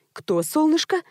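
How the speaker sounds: careful, puzzled asking back; cautious query. A narrator's reading. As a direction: ask it carefully, unsure you heard right. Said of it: "осторожно переспросила она".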